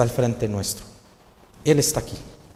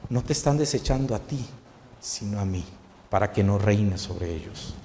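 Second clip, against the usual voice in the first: quiet divine reply; 5 semitones lower, 7 dB quieter than usual. Acted. God answers sad Samuel.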